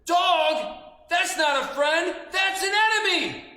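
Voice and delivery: high, loud alarmed yell; alarmed shout. Retold or imitated. Lion child spotting the dog, high alarmed shout. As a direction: warn everyone of the enemy. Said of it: "I said".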